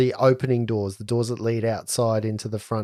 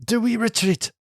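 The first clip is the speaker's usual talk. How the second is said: high nervous voice; 10 semitones higher than usual. Acted. GM voices Saloni.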